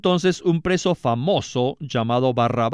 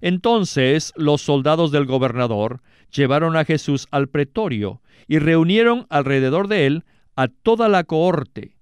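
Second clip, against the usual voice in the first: ordinary delivery, nothing marked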